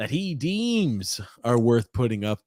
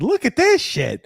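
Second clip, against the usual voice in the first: amazed; 14 semitones higher, 5 dB louder than usual. Real, not acted. Host amazed at a starred comment.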